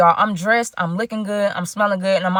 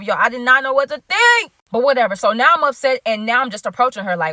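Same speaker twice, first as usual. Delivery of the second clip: ordinary talk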